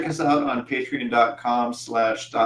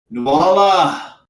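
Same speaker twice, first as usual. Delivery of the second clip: long, loud, high call of a name; yelling out; 8 semitones higher, 8 dB louder than usual. Acted. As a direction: call out a challenge across a battlefield. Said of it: "and yells out".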